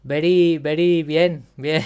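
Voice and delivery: put-on loud, broad Spanish-accented English; spanglish. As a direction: mash English into Spanish, proudly. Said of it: "puedes hacer Spanglish".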